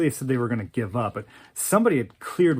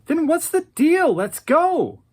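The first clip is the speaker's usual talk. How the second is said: much higher, louder, impatient snap; annoyed; 6 dB louder than usual. Retold or imitated. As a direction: push him out of the car, fed up. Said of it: "she got super annoyed with him".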